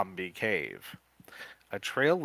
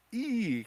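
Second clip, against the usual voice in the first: scream; 13 semitones higher than usual. Acted. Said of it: "Kim screams".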